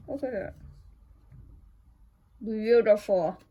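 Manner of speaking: loud burst of delight; delighted gush. Real, not acted. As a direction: gush over it, thrilled. Gushing over the new leaf.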